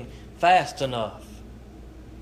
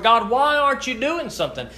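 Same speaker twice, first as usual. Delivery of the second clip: angry complaint; 7 semitones higher, 3 dB louder than usual. Retold or imitated. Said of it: "we may be angry with the Lord".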